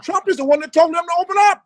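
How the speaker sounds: heated outburst. Real, not acted. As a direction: push it up in outrage. Heated rant about governor.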